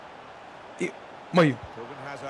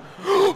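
shocked gasp; 13 semitones higher than usual. Real, not acted. Gamer shocked by play.

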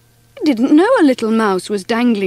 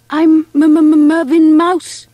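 high, slow nervous stammer; 5 semitones higher, 6 dB louder, slower than usual. A narrator's reading. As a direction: stutter out the name shyly. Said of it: "he stammered".

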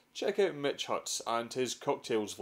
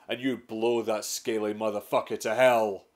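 ordinary delivery

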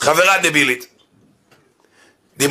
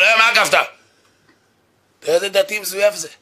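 mock indignant retort; 8 semitones higher than usual. Imitating driver's retort.

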